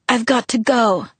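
a touch of need to get away; sudden urgency. A narrator's reading. Sick boy cutting off the phone call, mildly urgent. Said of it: "he said with sudden urgency".

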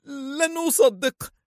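very high, slow, stretched cry; woman crying out her denial; drawn-out cry. A narrator's reading. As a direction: wail it out slowly. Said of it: "صرخت".

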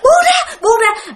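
very high, wailing cry to be released; mock tormented shriek, pleading; imitated agonized plea. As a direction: act out a man howling in pain. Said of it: "Thi diễn tả lại y chang như ổng làm".

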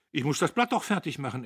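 a petty official's importance; self-important. A narrator's reading. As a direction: say it fast, full of your duty. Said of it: "wichtig wie ein kleiner Beamter".

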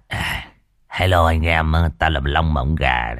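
low, slow, hoarse gang boss greeting; Long Mộng Gà hoarse voice. Retold or imitated. Storyteller greets his fans in his idol's voice, low and hoarse. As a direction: drop low and rasp it out slowly like a tough gangster. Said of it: "cái giọng khàn khàn đó".

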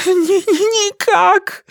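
high, stammering cry; exclaiming in panic. Acted. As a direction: stammer out a frantic denial. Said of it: "воскликнул Гаэтан".

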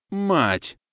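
high-pitched startled curse; exclaiming. A narrator's reading. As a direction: exclaim it as the danger hits you. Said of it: "воскликнул Григорьев". (изумлённое восклицание повыше)

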